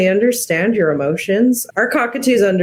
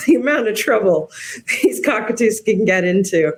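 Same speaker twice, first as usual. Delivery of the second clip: laughing; 3 semitones higher than usual. Real, not acted. Laughing while describing cockatoo mischief.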